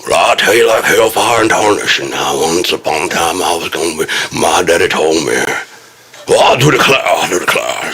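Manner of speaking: a hint of an old Southern gent's accent; Southern gentleman accent. Playing a Southern gentleman quoting his daddy, light Southern accent. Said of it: "as a Southern gentleman".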